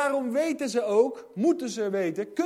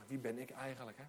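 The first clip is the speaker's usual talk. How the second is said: self-doubting mumble; 9 semitones lower, 16 dB quieter, faster than usual. Retold or imitated. Moses doubting himself.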